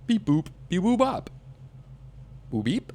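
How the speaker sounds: playful beep-boop; robot beeps. Pretend. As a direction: imitate a little droid. Imitates robot noises.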